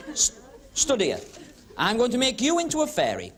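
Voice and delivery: ordinary delivery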